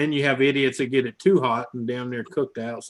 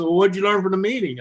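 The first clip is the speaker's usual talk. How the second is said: higher, louder, exasperated question; exasperated boss; 8 semitones higher, 6 dB louder than usual. Retold or imitated. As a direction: ask it again, fed up. Boss asks again, exasperated.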